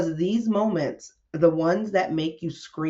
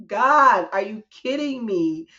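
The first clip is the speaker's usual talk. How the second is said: exasperated cry to God; 4 semitones higher, 4 dB louder than usual. Retold or imitated. Crying out to God.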